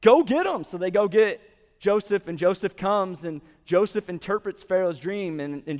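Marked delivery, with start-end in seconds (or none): 0.0-0.6 higher and louder royal command; commanding order. Retold or imitated. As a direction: snap an order like a king. Pharaoh orders Joseph brought.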